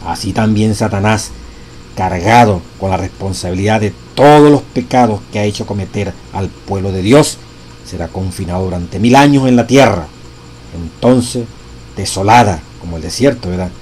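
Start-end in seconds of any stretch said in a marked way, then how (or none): 2.0-13.5 louder, weighty scripture reading; preacher proclaiming the text; solemn reading aloud. Acted. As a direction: read each phrase with gravity. Continues reading the quote.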